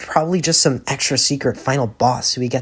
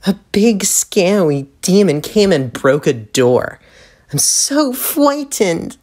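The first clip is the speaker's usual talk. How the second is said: slow, mock-frightened taunting voice; sarcastic; 2 semitones higher, slower than usual. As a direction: sneer in fake fear. Vriska mocks the group.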